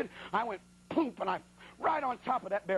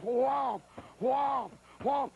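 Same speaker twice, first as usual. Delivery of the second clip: stabbing noises; 3 semitones higher, much slower than usual. Retold or imitated. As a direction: hammer out each whomp like a knife blow. Stabbing the bear with knife.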